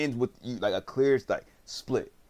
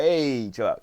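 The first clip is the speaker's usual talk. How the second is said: louder, higher retold "hey"; mock calling out; 4 semitones higher, 6 dB louder, slower than usual. Suho pulling his friend off, mock urgent call. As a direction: call out to break up a fight, acted lightly.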